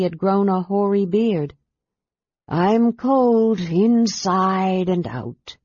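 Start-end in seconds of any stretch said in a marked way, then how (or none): 2.5-5.6 slow, glum dragon voice; a lightly voiced gloomy dragon. A narrator's reading. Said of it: "said the dragon glumly".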